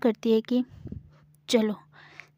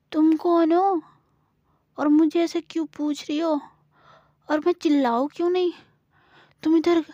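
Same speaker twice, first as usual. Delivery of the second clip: put-on higher, louder, rapid accusing girl; accusing child; 4 semitones higher, 4 dB louder than usual. Gauri accuses Samira of being a thief. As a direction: fire questions at her, then threaten to call mum.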